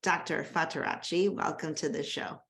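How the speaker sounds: normal talking voice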